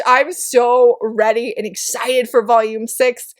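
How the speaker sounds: normal talking voice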